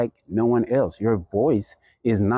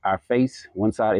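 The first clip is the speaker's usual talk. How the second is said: ordinary talk, nothing marked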